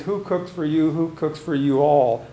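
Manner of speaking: ordinary delivery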